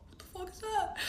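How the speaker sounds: hushed, high-pitched bewilderment; very soft, high mock disbelief; baffled reaction. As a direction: quietly ask it, completely thrown. Startled reaction to the chanting.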